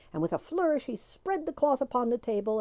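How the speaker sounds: ordinary delivery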